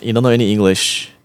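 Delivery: ordinary delivery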